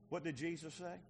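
ordinary delivery

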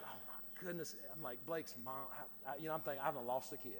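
low, quiet worried aside; hushed dread. Retold or imitated. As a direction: drop to a low murmur, as if it's sinking in. Retelling his dread at losing child.

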